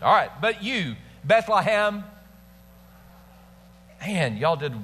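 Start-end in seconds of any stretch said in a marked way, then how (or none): 0.4-2.0 high, ringing proclamation; dramatic flair. Shown as a demonstration. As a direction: proclaim it like you mean it. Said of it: "with a little dramatic flair".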